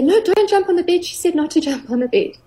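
high, prim little voice tattling; imitating a dutiful child. Child telling friends off about the bed, in a high prim voice. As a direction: play the rule-following kid who repeats mum's warning. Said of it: "the tomato children will say".

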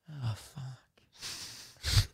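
barely audible curse to himself; whispered regret. Retold or imitated. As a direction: whisper it in sinking dismay. Re-enacts regret at admitting comedy.

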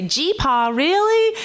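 put-on childlike Southern accent, high rising "really"; light Southern twang with a surprised rise. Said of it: "when I did have a Southern accent".

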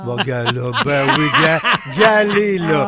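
loud laughing urging; laughing shout. Real, not acted. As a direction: laugh and call out.

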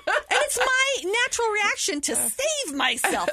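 high, loud laughing through the words; can't help cracking up at herself. Real, not acted. Widow laughing to the hosts about her near-miss.

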